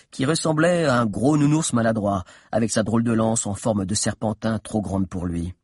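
normal talking voice

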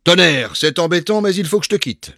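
high, loud exclamation; loud cry. A narrator's reading. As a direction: burst out in sudden alarm. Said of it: "et il cria". (s'écrier avec éclat)

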